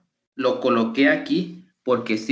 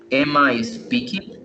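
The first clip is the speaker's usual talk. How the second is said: spoken slowly for the class to follow; slow, clear question; 2 semitones higher, slower than usual. Shown as a demonstration. Teacher quizzing the class, slow and clear question. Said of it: "Somebody can answer the question".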